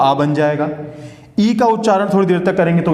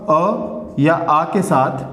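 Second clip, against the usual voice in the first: अ या आ के साथ stretched very slowly; slow, drawn out; much slower than usual. Shown as a demonstration. Teacher to students, dwelling on the rule, slow and drawn. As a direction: spell it out word by word, slowly. Teacher drawing out key phrase.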